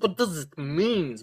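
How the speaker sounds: normal talking voice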